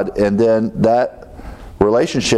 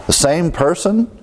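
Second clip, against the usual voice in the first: high-pitched mock disbelief at forgiving seven times; mock incredulous; 8 semitones higher than usual. Preacher mocking the congregation's stingy forgiveness, high incredulous.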